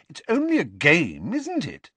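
high questioning exasperation; brushing them off impatiently; politely impatient. A narrator's reading. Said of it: "she said impatiently".

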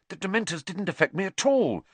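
eager, high-pitched boast; excited. A narrator's reading. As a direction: blurt it out with excitement. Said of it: "Harry said excitedly".

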